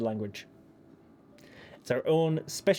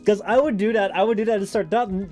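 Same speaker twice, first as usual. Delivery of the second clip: ordinary talk, nothing marked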